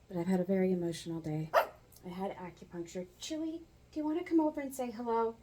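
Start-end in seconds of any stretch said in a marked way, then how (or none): 3.2-5.3 high calling voice, coaxing; calling the dog. Real, not acted. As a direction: call the dog over sweetly.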